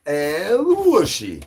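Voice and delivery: high drawn-out call. Acted.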